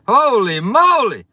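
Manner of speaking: high, loud cry of joy; real thrill over a big donation; Holy moly shouted high; excited shout. Real, not acted. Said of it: "I got so excited".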